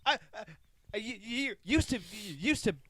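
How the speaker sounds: high, stammering repetitions; mock stutter. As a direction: trip over each word. Mocking a stutter.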